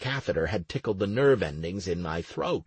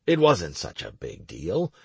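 ordinary delivery, nothing marked

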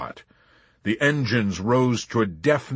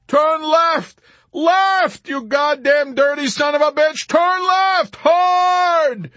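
very high, loud frantic orders; screamed commands; 8 dB louder, slower than usual. A narrator's reading. Yossarian directing McWatt out of flak, screamed command. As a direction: bellow the orders in a frenzy.

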